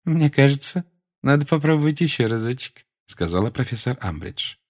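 high, saccharine lecturing; sugary Umbridge voice. A narrator's reading. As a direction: lecture with condescending sweetness. Umbridge insists.

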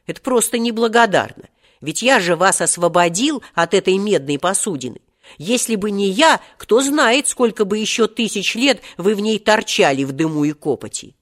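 indignant. A narrator's reading. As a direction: protest with mounting indignation. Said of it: "возмутился Серёжа".

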